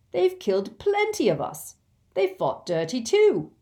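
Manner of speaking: high, indignant protest, loud at the end; Ron's riled voice, jumping up in pitch; heated argument. Acted. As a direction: argue back hotly. Ron argues against goblins.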